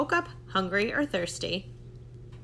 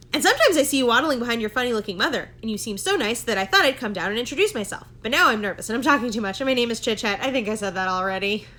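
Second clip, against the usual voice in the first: rambling chatterbox squirrel; 6 dB louder, faster than usual. Acted. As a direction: gush it all out without stopping for air. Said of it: "Chit Chat rambled on and on".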